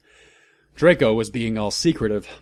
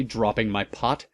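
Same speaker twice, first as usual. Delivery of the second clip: plain reading voice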